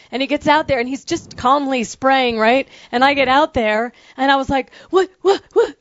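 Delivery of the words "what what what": higher, flustered repeated what; panicked what. Retold or imitated. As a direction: sputter in alarm. Retold panicked confusion.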